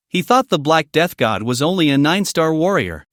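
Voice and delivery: plain reading voice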